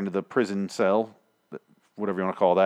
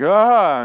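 high, loud "Ah!" of waking in fright; sudden loud startled yelp; startled waking yell; 9 semitones higher, 9 dB louder than usual. Retold or imitated. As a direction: jolt awake with a loud cry. Rick waking up, acted startle.

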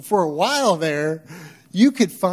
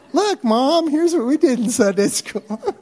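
put-on excited kid voice; 10 semitones higher, 6 dB louder than usual. Kid voiced in imagined story.

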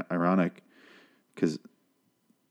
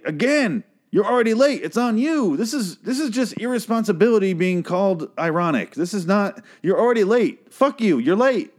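exasperated rant; 9 semitones higher than usual. Real, not acted. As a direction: scold the late driver, fed up and shrill-voiced. Ranting at lyric's late driver.

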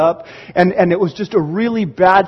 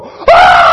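loud imitated gasp-scream of amazement; hammed-up scream at a big reveal; amazed scream; 8 dB louder than usual. Retold family seeing new house.

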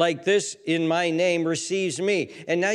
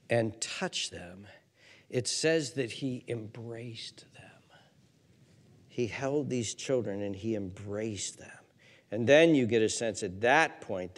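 tender hush; 5 semitones lower, 4 dB quieter than usual. Real, not acted. Preacher softly telling how Jesus held the children. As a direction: bring them in close, gently.

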